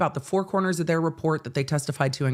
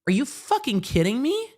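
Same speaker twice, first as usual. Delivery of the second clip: outraged disbelief; 7 semitones higher than usual. Retold or imitated. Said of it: "when you turn around and go".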